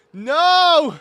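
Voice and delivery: loud, high cry of dismay as the glide fails; dismayed yell. Real, not acted. Gamer yelling as his character falls short. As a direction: yell out in real frustration.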